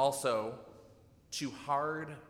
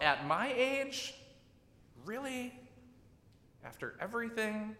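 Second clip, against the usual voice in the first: incredulous; 9 semitones higher than usual. Retold or imitated. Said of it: "She laughs and thinks to herself".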